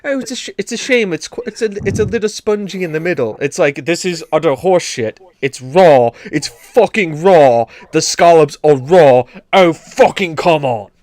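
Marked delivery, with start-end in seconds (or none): 0.0-3.4 higher, quicker prim British critique; polite English judge. Retold or imitated. Mocking baking show judges, higher quick prim English voice. 3.9-10.9 louder, hammed-up furious chef rant; angry chef. Imitating an angry TV chef, loud furious rant. Angry Ramsay style critique.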